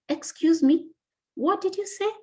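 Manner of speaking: high, questioning "excuse me?" of someone who didn't catch it; puzzled listener. Retold or imitated. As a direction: mimic a confused classmate asking her to repeat. People asking her to repeat.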